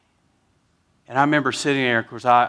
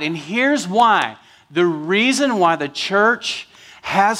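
preaching at full force; 5 semitones higher, 4 dB louder than usual. Acted. Pastor telling his church they can't quit, preaching hard. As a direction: drive the point home to the pews.

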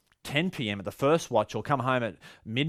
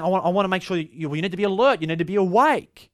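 higher, louder urgent warning; preached voicing of Jesus, raised and loud; Jesus urging; 7 semitones higher, 5 dB louder than usual. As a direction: press them to stay awake. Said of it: "Jesus is saying".

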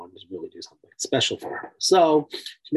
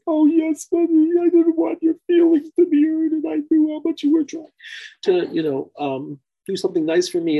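put-on high, louder little girl pleading; child's voice; 13 semitones higher, 4 dB louder than usual. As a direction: sweetly explain like a small daughter. Daughter voiced in retold story.

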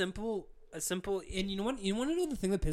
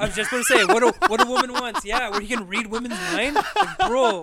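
laughing; 6 semitones higher, 13 dB louder than usual. Real, not acted.

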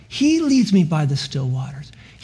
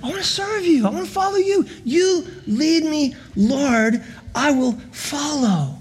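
put-on much higher, eager childlike devotion; joyful childlike prayer; 8 semitones higher than usual. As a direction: pray like a gleeful kid on a bike. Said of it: "may we be just".

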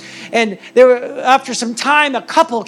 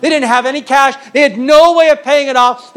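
preaching; 6 semitones higher, 6 dB louder than usual. Acted. Preaches the crisis.

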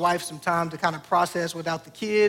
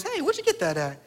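mild mom voice; 7 semitones higher than usual. Retold or imitated. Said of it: "Mom says".

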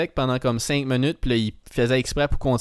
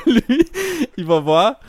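laughing; 11 semitones higher, 6 dB louder, slower than usual. Real, not acted. Still laughing after the outburst.

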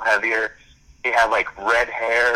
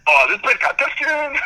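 very high, loud, raspy holler; squeezed raspy shout, hammed up; raspy party DJ; 4 dB louder than usual. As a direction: imitate a hyped-up spring break DJ. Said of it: "he had, like, such a raspy voice".

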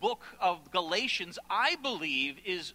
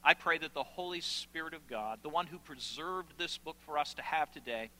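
lower, soft-ending pleas with long pauses; earnest prayer; 5 semitones lower, 3 dB quieter than usual. Real, not acted. Preacher asking the Father for grace, hushed earnest prayer. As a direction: pray quietly and sincerely aloud.

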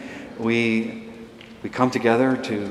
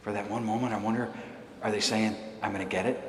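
hushed; 9 dB quieter, faster than usual. Real, not acted. Wondering how disciples felt.

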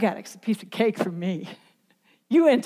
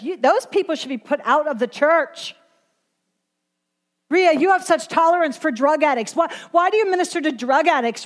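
disapproving church critics; 6 semitones higher than usual. Retold or imitated. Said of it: "You see, people say".

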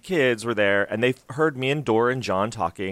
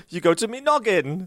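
playful mock Cockney, a bit higher; 7 semitones higher than usual. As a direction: echo it in cheeky Cockney.

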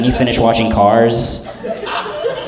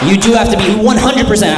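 emphatic shout; 9 semitones higher than usual. Real, not acted. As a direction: shout the warning at them. Insisting to the audience.

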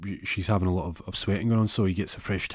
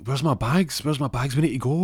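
high, pressing, raised voice; mildly panicked, pitched-up questioning; acted urgency; 6 semitones higher, 5 dB louder than usual. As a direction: demand urgently, about to go into labour. Wife going into labour, hunting bags.

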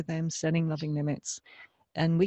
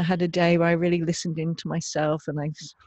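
ordinary delivery

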